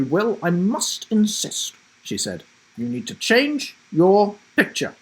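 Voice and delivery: high, firm and insistent; stern teacher. Acted. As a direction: insist with authority.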